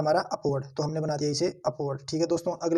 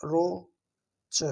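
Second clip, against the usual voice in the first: drawn-out word; 4 dB quieter, much slower than usual. Shown as a demonstration. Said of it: "तो इसलिए हम बनाएंगे इसे अपवर्ड".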